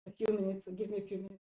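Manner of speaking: low, soft asking voice; quiet, unpressured request; calm request. Acted. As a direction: ask gently for a breather. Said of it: "you can just ask your partner".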